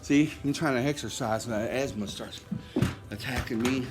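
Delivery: winded, breathing hard. Real, not acted. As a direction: talk while short of breath. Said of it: "I'll be out of breath here in a second".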